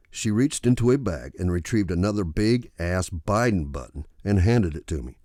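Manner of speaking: ordinary delivery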